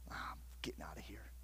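whispered, unvoiced; whispered resolve. Young man deciding to leave, in a whisper. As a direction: breathe it like a secret decision. Retold thought after grandfather died.

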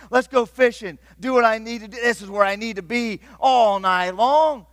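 loud, high, exasperated preaching; absolute frustration. Acted. Preacher voicing Peter's frustration, loud and exasperated. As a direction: pour out Peter's frustration at full force.

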